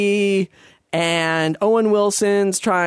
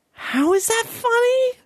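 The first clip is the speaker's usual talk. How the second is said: incredulous. Real, not acted. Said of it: "ranting about the movie".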